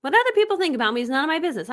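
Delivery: rattled off fast in one breath; brisk, lively repeat; quick recap. Shown as a demonstration. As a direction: run it through quickly without pausing. Same statement rattled off again.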